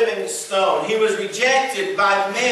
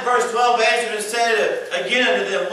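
normal talking voice

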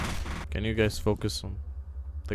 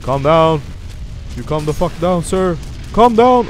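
loud, rising in pitch to a yell; exasperated yelling; 5 semitones higher, 9 dB louder than usual. Pretend. Player telling an attacking enemy to calm down, escalating yell. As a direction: order them to calm down, louder each time.

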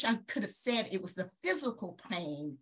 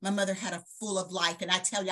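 ordinary talk

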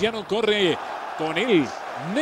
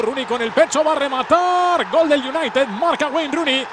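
excited goal call; 4 semitones higher, 5 dB louder than usual. Real, not acted.

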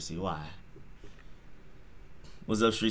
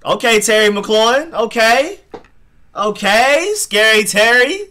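high, loud drawn-out okays; real fan thrilled at a big catch; excited cheering; 10 semitones higher, 8 dB louder, slower than usual. Real, not acted. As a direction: hype the catch as it happens. Big play to McLaurin.

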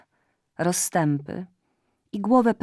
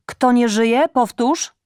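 demanding; 6 semitones higher, 8 dB louder, slower than usual. Acted. Mother demands repeat.

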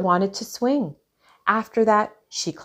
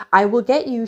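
louder, raised-pitch story threat; growled threat; 4 semitones higher, 5 dB louder than usual. A narrator's reading. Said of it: "she growled".

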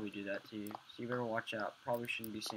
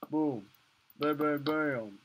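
weird booming noise; 4 semitones higher, 9 dB louder, much slower than usual. Acted. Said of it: "that was a weird ass noise".

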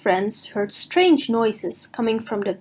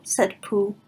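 plain reading voice

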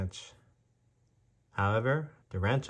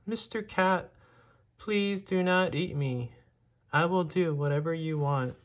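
high, pleading little mouse voice; begging mouse; 8 semitones higher than usual. A narrator's reading. Frightened mouse begs the cat not to eat him, high and pleading. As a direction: plead for your life like a tiny mouse. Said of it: "The mouse begged".

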